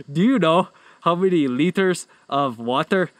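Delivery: badly faked Irish accent with odd t's; attempted Irish accent. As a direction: imitate an Irish professor's accent, clumsily. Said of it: "I'm done with the accent".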